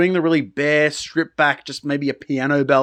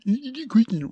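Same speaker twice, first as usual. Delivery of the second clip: higher, lightly mocking sing-song; mocking the lyric; 10 semitones higher than usual. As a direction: mimic the lyric to tease it. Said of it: "the very triggering".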